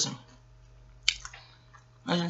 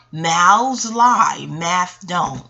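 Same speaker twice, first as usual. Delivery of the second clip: slow emphatic slogan; much slower than usual. Real, not acted. Repeating her slogan for emphasis.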